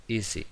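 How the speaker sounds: "easy" with a buzzed Z sound; S sonora. Shown as a demonstration. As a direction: model "easy" with vibrating S. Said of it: "Vemos que vibra ahí esa". ("easy" con ese sonora)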